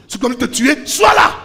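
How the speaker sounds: loud, rising preacher's cry to the crowd; fiery sermon delivery; full-force preaching. Acted. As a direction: preach it out, pushing each repeat harder.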